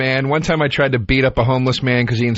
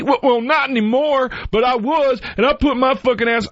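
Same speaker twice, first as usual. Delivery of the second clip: imitating woman; 9 semitones higher than usual. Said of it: "And I'm like".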